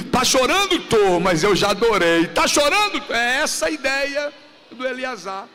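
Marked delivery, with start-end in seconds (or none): 0.1-3.0 fervent preaching dialogue. Acted. Preacher acting out a weeping worshipper's dialogue, loud fervent call and answer. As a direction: preach the exchange with rising force.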